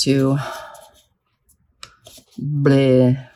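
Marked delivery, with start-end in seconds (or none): 2.4-3.3 a limp, fed-up bleh; blah noise. Real, not acted. Herself giving up mid-sentence, a tired bleh. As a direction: let it out like a deflating groan. Sound for feeling overwhelmed.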